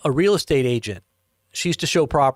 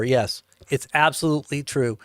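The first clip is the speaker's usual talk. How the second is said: ordinary talk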